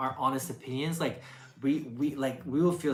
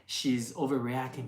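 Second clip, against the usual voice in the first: ordinary delivery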